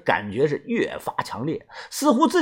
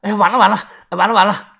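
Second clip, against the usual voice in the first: panicked; 4 semitones higher, 4 dB louder, faster than usual. A narrator's reading. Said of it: "豆芽仔突然说了一串的完了".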